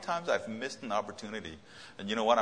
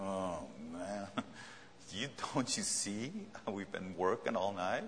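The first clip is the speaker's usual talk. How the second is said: groaning; 6 dB quieter than usual. Acted. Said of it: "He's groaning".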